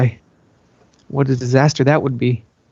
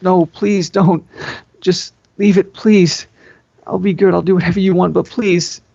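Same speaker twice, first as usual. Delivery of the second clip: pleading; 4 semitones higher than usual. Acted. Begging her sister.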